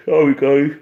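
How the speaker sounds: words stretched through a real yawn; tired end-of-Sunday yawn. Real, not acted. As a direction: say it mid-yawn, loud and open-mouthed. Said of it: "Don't know where the yawning comes from".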